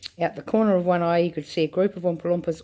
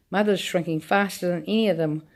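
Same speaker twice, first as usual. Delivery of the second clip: ordinary delivery, nothing marked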